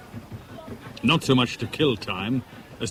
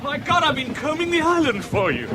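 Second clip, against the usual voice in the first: high, breathless rush of relief; overjoyed greeting; excited relief; 14 semitones higher than usual. Acted. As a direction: pour out the relief of finding her. Relieved reunion after searching everywhere.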